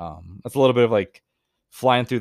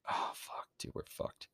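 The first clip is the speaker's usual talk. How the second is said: whispered dismay; 17 dB quieter than usual. Real, not acted. Bad roll result.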